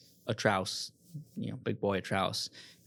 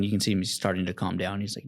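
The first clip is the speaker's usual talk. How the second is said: normal talking voice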